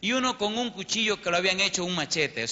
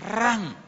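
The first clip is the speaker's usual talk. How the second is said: high, sudden "ran" as the knife goes in; imitated stabbing noise; 6 semitones higher than usual. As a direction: mimic a blade plunging in. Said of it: "Yo vi el cuchillo cuando entró". (sonido imitado de una cuchillada)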